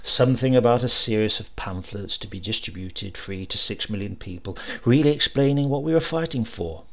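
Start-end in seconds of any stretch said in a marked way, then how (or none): none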